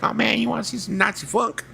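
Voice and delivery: hammed-up imitation of an eager pal; mimicking Carl. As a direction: ham up the excited friend's pitch. Mimicking Carl's invitation.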